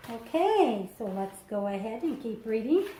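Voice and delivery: ordinary talk, nothing marked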